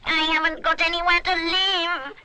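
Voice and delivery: high, loud wail of grief; hollering creature. A narrator's reading. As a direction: holler it like a grieving imp. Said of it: "it hollers".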